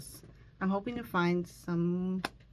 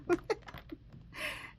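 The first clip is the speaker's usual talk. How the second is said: high breathy laugh; 12 semitones higher than usual. Real, not acted. Laughing at own story.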